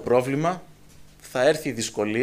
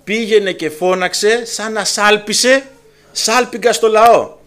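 louder, higher commanding call; fervent preacher's charge; preaching at full force; 6 semitones higher, 7 dB louder, slower than usual. Acted. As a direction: proclaim it like a trumpet. Preaching slow and loud.

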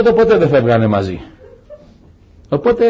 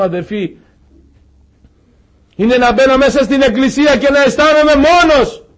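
raised, high preaching voice, pleading; 9 semitones higher, 5 dB louder than usual. Acted. Preacher comforting the lonely congregation, raised and pleading. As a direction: preach it to the brothers, full of feeling.

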